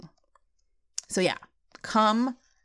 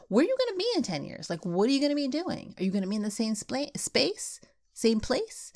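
worked-up questions; 5 semitones higher than usual. Real, not acted. Host challenges listeners about their future.

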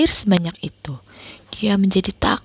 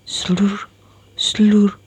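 usual speaking voice

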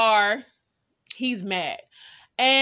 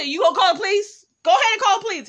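high, loud retold challenge; mock-daring him, pitched way up; defiant dare; 10 semitones higher, 5 dB louder, much faster than usual. Woman to boyfriend threatening police, loudly defiant.

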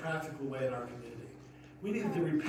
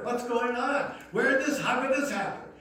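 a mock astonished crowd, higher and louder; amazed wondering; 8 semitones higher, 7 dB louder than usual. Preacher voicing an astonished crowd about the healed man, higher and louder. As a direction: voice the crowd's wonder, pitched up and loud.

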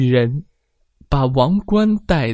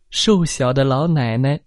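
usual speaking voice

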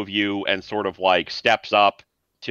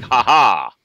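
triumphant ha ha; 7 dB louder than usual. Acted. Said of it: "look triumphantly like".

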